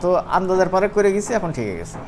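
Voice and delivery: ordinary talk, nothing marked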